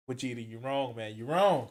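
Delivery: ordinary talk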